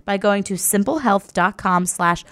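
upbeat sponsor read. Acted. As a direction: read it like a podcast ad. Host reads sponsor ad.

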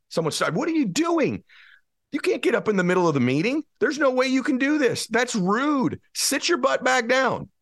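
fired-up motivational preaching; full-force preaching. Acted. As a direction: preach it hard, pitch climbing.

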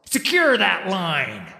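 loud, slow shouted command; shouting orders. Acted. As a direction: shout it above the storm. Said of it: "shouting orders".

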